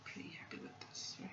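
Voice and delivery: unvoiced whisper to herself. Real, not acted. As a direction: whisper it under your breath. Muttering to self while drawing.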